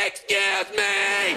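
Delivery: very high, drawn-out squealed mimicry; mocking the lady. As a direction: stretch it into a jeering squeal. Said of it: "mock her and be like".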